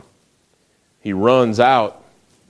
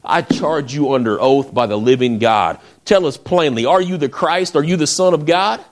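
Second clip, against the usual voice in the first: urgent questioning, climbing higher in pitch; demanding, rising higher with each question; desperate demand; 5 semitones higher, 3 dB louder than usual. Retold or imitated. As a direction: press for an answer as a desperate high priest. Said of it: "in a move of desperation".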